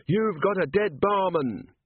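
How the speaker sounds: high, slow, drawn-out threat; threatening robber. A narrator's reading. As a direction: warn the whole room, pitched high. Robber threatens the whole room.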